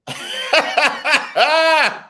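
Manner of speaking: a high burst of laughter. Real, not acted. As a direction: laugh helplessly. Laughing at the daughter.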